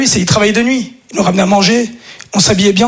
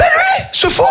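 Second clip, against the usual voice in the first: very high outraged squeak; shocked squeal; slower than usual. Acted. Comedian to audience, squealing in mock shock.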